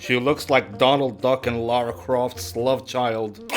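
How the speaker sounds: ordinary talk, nothing marked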